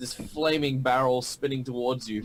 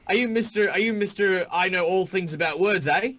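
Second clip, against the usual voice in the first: high, loud taunting Australian; Australian accent; 6 semitones higher, 4 dB louder than usual. Acted. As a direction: needle him like a cocky Aussie bruiser. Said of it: "the Hobgoblins in my world are Australian".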